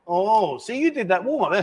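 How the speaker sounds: ordinary talk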